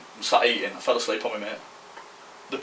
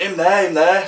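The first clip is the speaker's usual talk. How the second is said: high, louder imitated call; mock shouting over; 9 semitones higher, 6 dB louder, slower than usual. Girl picking him out among the lads, mock shout. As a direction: call out to single someone out. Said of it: "like, shouting over to all lads".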